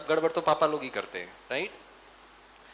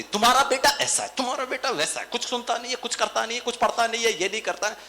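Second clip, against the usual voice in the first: nagging wife; 6 semitones higher, faster than usual. Retold or imitated. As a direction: pile the complaints on him one after another. Wife nagging husband about son. (ऊँची आवाज़ में शिकायत करती पत्नी)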